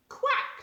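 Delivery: mock duck quack, high and unvoiced. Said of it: "says the duck".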